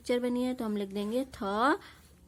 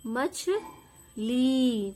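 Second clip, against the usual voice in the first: मछली repeated, even slower and drawn out; repeated dictation; much slower than usual. Shown as a demonstration. Teacher repeating मछली slowly for pupils to write. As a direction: stretch मछली out once more for the class. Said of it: "क्या बोला मैंने".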